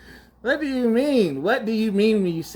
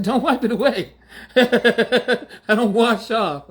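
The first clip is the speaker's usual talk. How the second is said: laughing; 4 semitones higher, 4 dB louder, slower than usual. Real, not acted. Laughing mock of the excuse.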